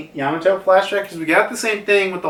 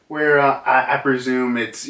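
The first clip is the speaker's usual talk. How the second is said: ordinary delivery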